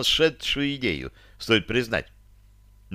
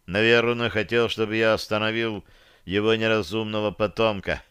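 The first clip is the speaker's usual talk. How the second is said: plain reading voice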